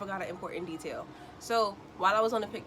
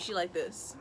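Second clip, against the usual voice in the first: ordinary talk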